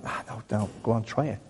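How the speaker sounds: soft, gentle nudge, lightly voiced; God coaxing. God nudging a reluctant believer to try, softly coaxing. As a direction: coax quietly, as if brushing off a worry. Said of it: "And God's going".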